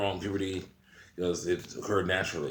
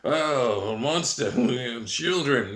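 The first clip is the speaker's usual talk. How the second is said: mock outrage; 5 semitones higher, 5 dB louder, much slower than usual. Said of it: "'cause they'll be like".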